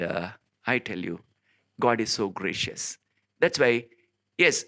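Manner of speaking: normal talking voice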